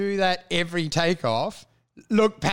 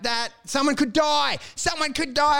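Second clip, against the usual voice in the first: higher-pitched mock outrage; alarmed scolding; 7 semitones higher than usual. Family protests the stolen life jacket, alarmed scolding. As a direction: imitate appalled relatives protesting. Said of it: "it was not well received".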